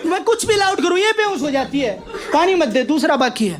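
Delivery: high, fast, rough Haryanvi threats; high fast Haryanvi ranting mother; loud Haryanvi mother. Acted. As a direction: yell like a furious desi Jat mum. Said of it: "देसी हरियाणा की जाट".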